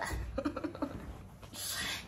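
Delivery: high breathy giggle. Real, not acted. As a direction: giggle shyly at the camera. Shy laugh at camera.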